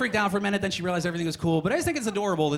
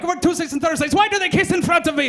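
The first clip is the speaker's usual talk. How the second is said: put-on high, loud accented rant; ranting cab driver; 11 semitones higher, 7 dB louder than usual. Cab driver calls in his schedule, yelling in a mock accent. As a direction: blurt it all out as the yelling cabbie. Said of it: "He calls in his schedule".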